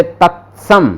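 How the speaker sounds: तत्सम with a crisp ts cluster; slow, stress the ts in तत्सम; half t sound. Shown as a demonstration. Teacher using तत्सम to model the ts sound. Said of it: "वो आधा तय निकलना बहुत मुश्किल होता है".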